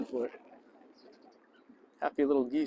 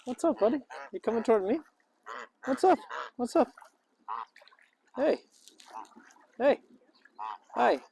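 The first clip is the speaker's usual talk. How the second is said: much higher friendly greeting; high pet talk; 7 semitones higher than usual. Real, not acted. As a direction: chirp at the bird to welcome it. Greeting an approaching bird.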